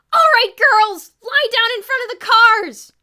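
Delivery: very high, very loud, slow rallying scream; screamed command. A narrator's reading. Kathy from the balcony to the girls, screaming.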